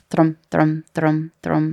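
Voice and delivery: slow, even thrums like a resting heartbeat; calm slow heartbeat. Retold or imitated. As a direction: lightly tap out a calm pulse, each "thrum" spaced out. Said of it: "Fisher made a point of being like".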